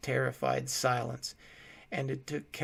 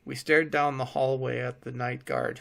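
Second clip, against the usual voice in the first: ordinary delivery, nothing marked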